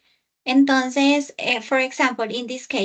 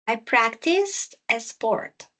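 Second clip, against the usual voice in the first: slow correction; slower than usual. Shown as a demonstration. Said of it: "teacher repeats slowly".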